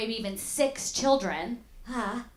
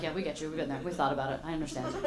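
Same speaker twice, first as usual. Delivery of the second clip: low, subdued acted commiseration; understated, resigned agreement; weary sympathy; 6 semitones lower, 6 dB quieter, faster than usual. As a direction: quietly confide as a worn-down spouse. Weary married people sympathising with divorce.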